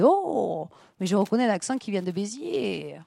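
slow, drawn-out connoisseur's verdict; wine connoisseur. Retold or imitated. Man placing her accent like a vintage, slow connoisseur impression. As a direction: savour it like a wine taster, slowly. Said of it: "j'ai imité un peu comme un connaisseur de vin".

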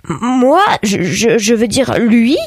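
stammering, flustered disbelief; strangled cry. A narrator's reading. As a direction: stumble over the words, rising to a startled question. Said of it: "Puis il eut un cri étranglé". (cri étranglé, bafouillant de surprise)

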